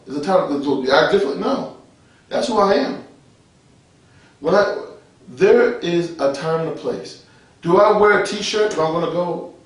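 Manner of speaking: ordinary talk, nothing marked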